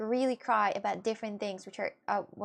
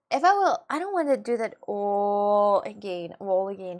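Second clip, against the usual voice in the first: exasperated outburst; 2 semitones lower, 7 dB louder than usual. Real, not acted. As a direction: push it out loud and drawn. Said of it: "I wasn't trying hard at all".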